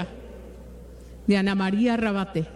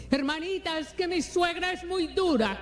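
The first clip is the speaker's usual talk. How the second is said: pitched-up, softer whine of a put-upon daughter-in-law; whiny complaining sister; 6 semitones higher, 3 dB quieter than usual. Retold or imitated. Preacher imitating a congregant complaining about her mother-in-law, higher, soft whine.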